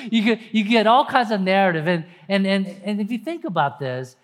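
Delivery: normal talking voice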